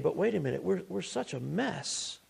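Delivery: hushed, doubtful aside; soft, hesitant reconsidering; human second-guessing. Acted. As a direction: voice a nagging doubt very softly. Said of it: "But then with human reasoning".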